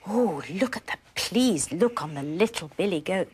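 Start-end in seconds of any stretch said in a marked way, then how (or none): none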